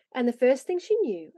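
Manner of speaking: ordinary delivery, nothing marked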